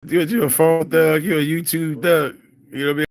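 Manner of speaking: high, loud mocking tease; teasing. Real, not acted. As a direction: rib the commenter. Teasing commenter as phone thug.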